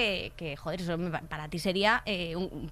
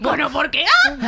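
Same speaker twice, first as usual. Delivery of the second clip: put-on squeaky, louder teen voice with a crack; mock voice-breaking boy; 2 semitones higher, 8 dB louder than usual. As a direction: imitate a teenage boy whose voice cracks, laughing. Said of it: "Es como que habla".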